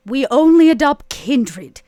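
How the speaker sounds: firm declaration. Acted. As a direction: lay down the rule with a firm call. Vera rebuffs raccoon, firm.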